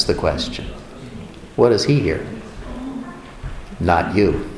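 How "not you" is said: low, quiet flat judgment; mirror's verdict. Acted. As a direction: deliver the verdict low and soft.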